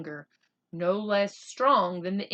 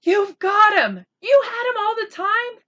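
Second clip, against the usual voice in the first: much higher and louder, overjoyed; shout of joy; 14 semitones higher, 10 dB louder than usual. Acted. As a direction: burst out in delighted disbelief. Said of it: "Omri uttered a shout of joy".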